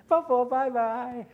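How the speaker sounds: put-on high, slow little girl voice; toddler goodbye. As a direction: wave goodbye like a small child. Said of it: "she says".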